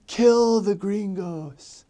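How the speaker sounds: long drawn-out high call; mock battle cry. Rioter rousing the crowd, slow high mock cry. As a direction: imitate a distant mob chant, stretched and high. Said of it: "I hear one guy say".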